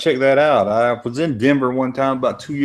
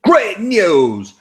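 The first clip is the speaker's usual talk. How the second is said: high, loud mock proclamation; gleeful announcement; 8 semitones higher, 5 dB louder than usual. Streamer playing the man who is now the Senate, triumphant.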